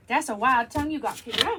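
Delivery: ordinary talk, nothing marked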